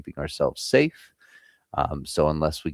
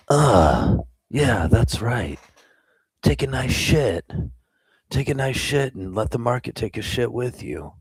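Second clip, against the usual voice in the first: ASMR voice; 7 semitones higher, 3 dB louder than usual. Pretend. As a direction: ham up a soothing ASMR whisper. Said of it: "did I do it right? Am I doing A S M R right?".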